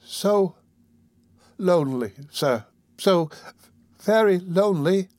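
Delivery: put-on halting, tearful little-boy voice; forlorn, faltering confession; crying child. A narrator's reading. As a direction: break the words apart as if crying. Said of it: "cried the child".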